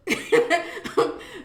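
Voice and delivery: real laughing fit; loud laugh. Real, not acted. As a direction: burst of high, loud laughter. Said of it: "Mama had me laughing".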